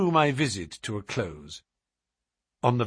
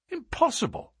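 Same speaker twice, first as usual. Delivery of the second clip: shocked cry. A narrator's reading. Narrator hearing his friend's father is dying, shocked cry. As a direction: exclaim it high in disbelief. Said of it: "I cried".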